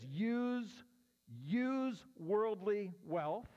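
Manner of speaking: "use" drawn out slowly, leaning hard on it; stress on use. Shown as a demonstration. Pastor teaching the congregation, stressing "use". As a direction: land heavy on "use" with a slow rise. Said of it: "Emphasis on the word use".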